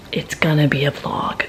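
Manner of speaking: whispered repeat of the line; secretive whisper to camera. Shown as a demonstration. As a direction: drop to a breathy whisper. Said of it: "repeats line as a whisper".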